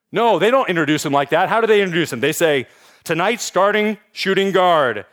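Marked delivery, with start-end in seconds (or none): 3.0-5.1 hype arena announcer. Retold or imitated. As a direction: build the intro up and stretch the name like a PA announcer. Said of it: "They call it out".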